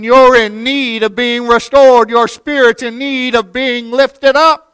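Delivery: fervent sermon cadence; impassioned preaching. Acted. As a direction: plead with the congregation.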